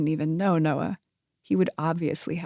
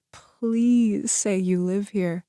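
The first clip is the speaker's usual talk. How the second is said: slow, drawn-out hopeful plea; 3 semitones higher, much slower than usual. Acted. Piper silently pleading he lives here, slow and wistful. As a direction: stretch each word, wishing hard.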